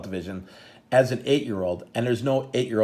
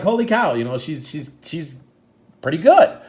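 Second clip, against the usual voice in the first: excited; 5 semitones higher, 5 dB louder than usual. Retold or imitated. Proud excited parents.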